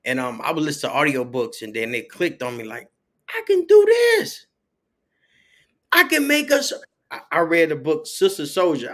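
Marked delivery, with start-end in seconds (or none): 3.3-4.5 high, loud, drawn-out burst of self-belief; excited realization. Retold or imitated. Author recalling the idea clicking, excited realization. 5.9-6.7 high, loud eureka, breaking off; excited realization. Retold or imitated. Author retelling inspiration from audiobooks, excited realization.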